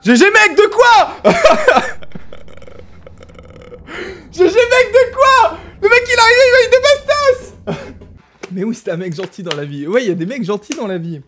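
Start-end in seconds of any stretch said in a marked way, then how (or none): 0.0-7.9 very high, loud mock yelling; hammed-up outrage. Gamer mocking an opponent's "GG mec", high laughing screech.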